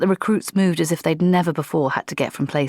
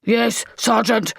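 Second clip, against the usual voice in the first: troll growl; 6 semitones higher, slower than usual. Acted. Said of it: "growled the troll".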